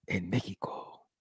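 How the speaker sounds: low whispered trailer line; movie trailer voice. Acted. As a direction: hush it like a cinema promo. Mock trailer, hushed.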